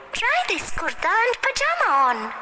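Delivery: put-on high-pitched excited girl; high girlish storybook voice; little girl character. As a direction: show off a find in a high child's voice.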